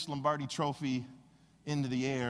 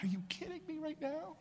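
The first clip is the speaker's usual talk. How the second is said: incredulous; 11 semitones higher, 9 dB quieter than usual. Retold or imitated. Exhausted at trainer's demand.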